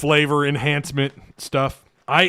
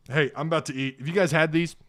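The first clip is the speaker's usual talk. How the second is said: ordinary talk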